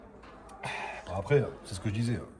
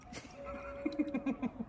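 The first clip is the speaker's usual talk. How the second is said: laugh; 7 dB quieter than usual. Real, not acted. Laughs at having the wrong filter.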